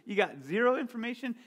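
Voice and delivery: high, loud mock outrage at the pilot; incredulous. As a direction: squeak it out in disbelief. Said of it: "I'm like".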